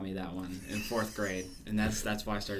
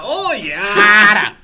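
mock ecstatic cry; 11 semitones higher, 19 dB louder than usual.

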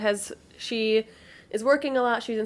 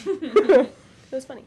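laughing; 3 semitones higher, 4 dB louder, much slower than usual. Real, not acted. Laughing about husband.

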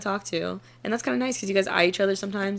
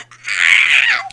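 mock yell; 13 semitones lower, 10 dB louder than usual. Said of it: "she goes".

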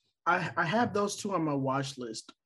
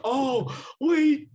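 sudden realization; 11 semitones higher, 6 dB louder than usual. Real, not acted.

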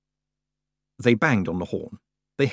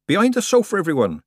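high, loud urgent yell; yelled warning; 11 semitones higher, 8 dB louder than usual. A narrator's reading. Nanny yells everyone behind the sofa, loud urgent yell. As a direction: yell them all to cover. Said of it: "yelled Nanny".

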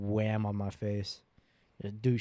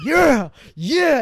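loud, high whoop of victory; triumphant yell; 12 dB louder than usual. Retold or imitated. Brother re-enacting his punch on sister, triumphant yell. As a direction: burst out in a re-enacted triumphant shout.

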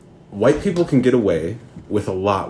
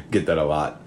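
ordinary talk

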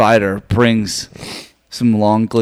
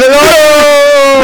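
long, high, loud comic cry; hammed-up sobbing wail; mock wail; 13 semitones higher, 9 dB louder than usual. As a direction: bawl it out in fake despair. Acted family meltdown.

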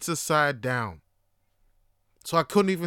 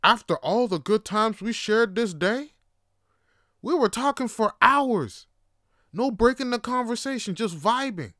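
rising melodramatic anguish; hammed-up betrayed lament, building higher; mock heartbroken plea; 6 semitones higher than usual. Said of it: "looked at her like she just killed my child".

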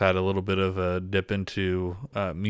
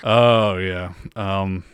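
ordinary delivery

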